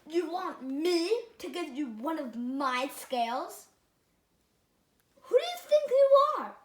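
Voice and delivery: rising to a high, loud indignant cry; haughty fish, building to a loud high cry. Acted. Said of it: "cried the Rainbow Fish".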